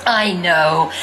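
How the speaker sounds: usual speaking voice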